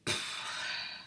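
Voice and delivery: long breathy sigh. Real, not acted. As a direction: let out an audible sigh. Sighs while settling on placeholder text.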